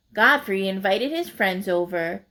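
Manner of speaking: plain reading voice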